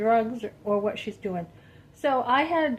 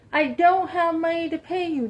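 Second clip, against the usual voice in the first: mimicked Ruth, higher and louder on the repeat; Ruth's repeat, louder; 7 semitones higher, 5 dB louder than usual. Imitating the friend refusing to pay, louder mock insistence. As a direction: push the second time, mock-exasperated.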